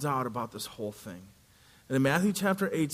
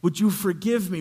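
pleading; 3 semitones higher, 5 dB louder than usual. Acted. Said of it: "he begs the king".

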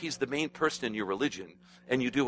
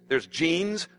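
normal talking voice

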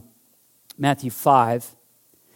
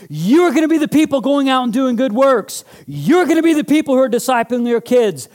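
high, loud fervent sermon voice; preaching at full force; 10 semitones higher, 6 dB louder than usual. Real, not acted. Pastor preaching Jesus' words to the congregation in full fervour. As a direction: preach it with passion.

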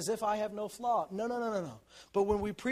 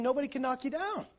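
ordinary talk

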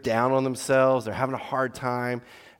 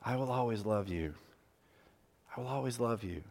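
soft tender vow; 8 dB quieter than usual. Shown as a demonstration. Modeling words for daughters.

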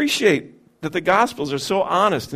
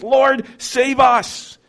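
pleading disciple; 7 semitones higher, 4 dB louder, much slower than usual. Acted. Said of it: "That's faith talking".

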